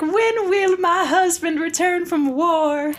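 high, wistful mock longing; hammed-up yearning, pitched high; mock pining Padme. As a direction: play up a lovesick wife at the window. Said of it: "she's like".

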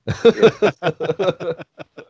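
high, long burst of laughter. Real, not acted. Laughing after the story.

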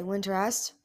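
plain reading voice

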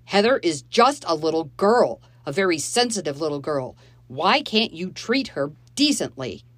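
loud, yelling stepfather. Acted. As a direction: yell at them in a rage. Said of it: "He was yelling now".

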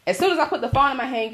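ordinary talk

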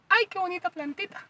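very high, squeaky mock voice of his mom; mocking mom's voice. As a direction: ham up his mom's delighted cooing. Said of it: "Así habla tu mamá".

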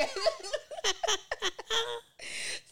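high, loud laughter tipping into tears; real laughing fit that breaks toward crying; helpless high laughter. Real, not acted. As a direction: laugh until it almost turns to crying.

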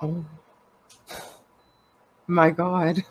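normal talking voice

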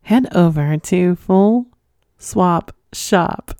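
slow, deliberate read of a tongue twister; careful, slow plug of the shop name. Real, not acted. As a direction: take it slowly so you don't trip. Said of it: "I have to say that so slow because it's a tongue twister".

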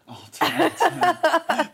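cracking up while repeating; laughing. Real, not acted. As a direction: laugh out the words, high and loud. Echoing friend's joke.

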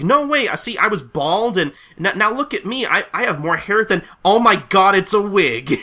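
high, loud, rising to an outcry; protest, then blurt out the discovery; shocked realization. Acted. Afro man discovers wig.

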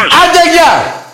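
loud, high scornful send-off; cutting off the Panathinaikos caller with a loud scornful goodbye; dismissive shout. Real, not acted. Hangs up on rival fan caller.

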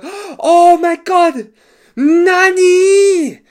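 high, loud, slow shocked scream. Retold or imitated. Dojo students screaming as their coach taps out. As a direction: wail in shocked disbelief. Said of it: "They screamed".

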